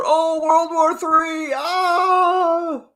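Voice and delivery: very high, drawn-out wail of fake terror; mock panicking YouTubers. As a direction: imitate hysterical doom-mongers, ending in a high mock scream. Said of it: "you got other YouTubers out there".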